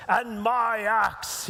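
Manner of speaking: slow, emphatic dwarf voice; mock-heroic dwarf pledge; Gimli impression. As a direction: put on a dwarf's voice and draw out each word. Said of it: "Gimli the dwarf says".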